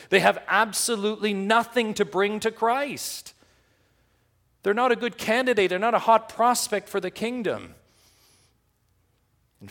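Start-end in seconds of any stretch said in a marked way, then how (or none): 0.1-7.7 much higher and louder, driving it home; fervent preaching. Real, not acted. Preacher telling the church the men had nothing to bring, at full force.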